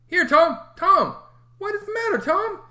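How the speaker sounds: put-on high, loud, alarmed child's call; worried boy calling out; anxious. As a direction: Sid frightened for his brother. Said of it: "looked at his face anxiously".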